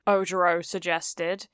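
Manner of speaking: ordinary delivery, nothing marked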